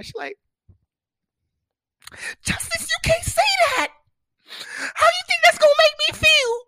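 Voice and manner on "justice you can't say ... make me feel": very high, loud, wailing imitation of her sobs; mock sobbing girlfriend. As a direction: ham up her tearful protest. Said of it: "She starts crying".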